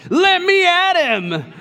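high, loud, slow laughing mock rage; playful yelled threat through laughter; mock fighting shout. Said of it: "She reared her fist back".

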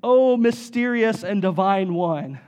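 slow, hammed-up solemn prayer voice; mock a vague chapel prayer, drawn out slowly; vague lofty prayer. Pastor mocks vague chapel prayers, slow and hammed-up solemn.

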